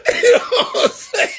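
high voice through laughter; laughing. Real, not acted. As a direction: repeat the line while cracking up. Laughing through repeated punchline.

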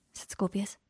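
usual speaking voice

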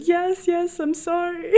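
high, hammed-up weepy voice; mock tearful apology. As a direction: imitate a sobbing apology, nearly laughing. Retells tearful apology to driver.

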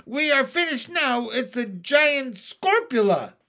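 high, loud cry of alarm; yelled. A narrator's reading. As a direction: yell it like all is lost. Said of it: "yelled the head of the fire department".

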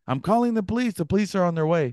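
high-pitched yell at normal volume; mock screaming. Homeowner shouts from his locked room, mock screaming. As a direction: imitate a panicked shout from afar. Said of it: "he's screaming from his room".

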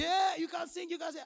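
high, loud mimicking of a pastor; acted-out shout of discovery; imitated excited call. Acts out pastor's eager call.

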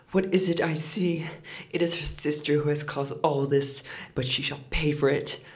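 high, loud angry outburst; furious. A narrator's reading. Mother blaming her other daughter, furious. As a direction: vow revenge in a rage. Mother enraged, runs to beat.

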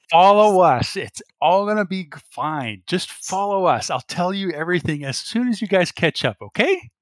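high, loud, insistent reassurance; Kirsten. Acted. Kirsten urges Cecile to follow, high insistent reassurance. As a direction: urge her along in a high, swooping voice. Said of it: "Kirsten's like".

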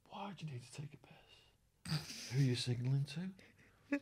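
hushed suspicious whisper; secretive whisper; paranoid whisper. Retold or imitated. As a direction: breathe it like a conspiracy. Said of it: "the drinking partner goes".

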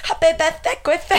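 high, laughing cartoon-pig voice; Peppa in Ibiza accent. Offering to fake the birthday call, high and giggly. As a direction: squeak it out through laughter. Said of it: "I'll do Papa in an Ibiza accent".

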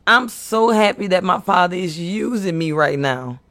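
usual speaking voice

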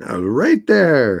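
high, stretched-out echo; mock the brazen claim, slow and high; mimicking the punchline. Host echoes the friend's punchline, mimicking.

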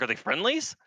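high, doubtful mock ask; puzzled question. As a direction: imitate a confused soldier asking. Soldier asks about approaching men.

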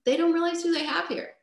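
talking yourself into giving up; desperate inner voice. Retold or imitated. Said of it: "I realized".

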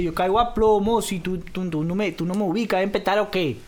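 put-on cocky, thick Venezuelan slang accent; Petare malandro. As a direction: threaten like a barrio hoodlum. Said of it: "Como malandro de Petare".

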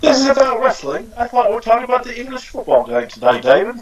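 put-on English accent, pitched high. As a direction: play an indignant-sounding English football fan in a high English accent. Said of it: "great accent".

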